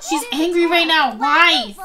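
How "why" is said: usual speaking voice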